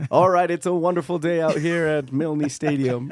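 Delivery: laughing mock stadium announcer; play-by-play voice with a laugh; sportscaster voice. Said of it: "let's hear your sportscaster voice".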